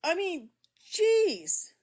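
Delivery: genuinely hurt and incredulous; upset. Real, not acted. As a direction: plead in real upset, pitch lifted. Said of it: "am I not supposed to be upset over that".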